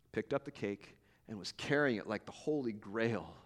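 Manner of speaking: ordinary delivery, nothing marked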